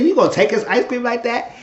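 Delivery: high, loud retold disbelief; incredulous challenge. Own shocked challenge retold.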